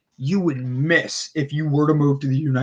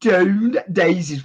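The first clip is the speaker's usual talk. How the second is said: put-on South African accent; 3 semitones higher, slower than usual. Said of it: "the South African accent coming out".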